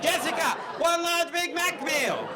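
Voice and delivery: high, loud bellow, drawn out; over-the-top slow shout; shouted order. Acted. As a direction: holler it like a stage encore at the drive-thru. Bellowing an order at the drive-thru.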